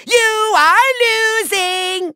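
slow, high, drawn-out gloat; gloating taunt. Acted. Villain telling the Skylander he is losing, gloating. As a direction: stretch each word out to rub it in.

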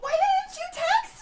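excited shriek. Acted. Friend shrieks at missed news.

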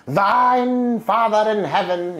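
over-the-top pious intoning; mock lofty prayer. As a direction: ham up a pompous high prayer. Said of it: "it's not like that".

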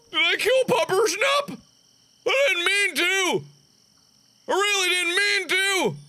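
high, upset, pleading; plead, horrified and guilty; distressed pleading. Real, not acted. Horrified at killing the dog.

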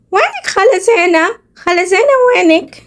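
put-on high childish call for auntie. Kids at the door calling for aunt Zeina, high and childlike. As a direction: call out like a little kid looking for her.

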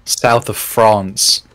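South of France drawn out very slowly; slow, dreamy "South of France"; slow savoring. Shown as a demonstration. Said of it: "you just mean the south of France".

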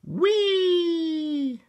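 very high, long gleeful "whee"; singsong whee. A narrator's reading. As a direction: squeal one long held high note. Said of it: "sang Froggy".

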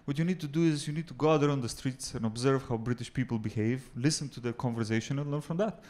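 a hint of a mate giving sensible advice; friend's advice. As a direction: lay out the tip calmly and quietly. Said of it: "He said".

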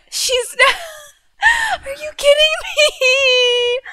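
high, slow laugh-crying shout; laughing disbelief. Real, not acted. Gamer laugh-crying in disbelief at her mistake. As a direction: laugh through despair. Disbelief after deleting urn.

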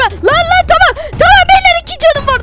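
scared pleading; panicked pleading. Acted. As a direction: beg them not to hurt you. Begging captors, low on hearts.

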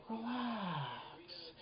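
soft, high, drawn-out croon; beautiful, lilting hush; melodical tone. Shown as a demonstration. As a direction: say it as sweetly and musically as possible. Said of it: "in the most beautiful, melodical tone".

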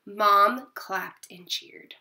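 ordinary delivery

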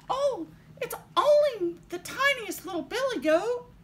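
high, tiny billy goat; very small voice. A narrator's reading. Youngest goat answering the troll, very small voice. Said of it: "in his very small voice".

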